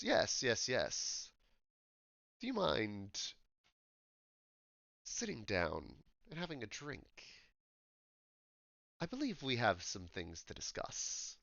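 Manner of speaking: softly spoken, imperfect British gentleman; halting attempt at polite British; attempted British accent. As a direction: try British for an older, businesslike elf. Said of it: "I'm trying to do British".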